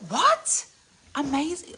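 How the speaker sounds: high loud amazed "what"; surprised. Real, not acted. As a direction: exclaim in disbelief, pitch way up. Amazed exclamation.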